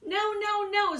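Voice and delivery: high, squeaky little wolves; squeaky wolves refusing. Acted. Little wolves squeak their refusal to the pig. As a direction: pipe it up high like tiny wolves.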